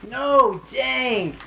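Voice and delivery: high loud groan of dismay; frustrated outburst. Real, not acted. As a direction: burst out in frustration.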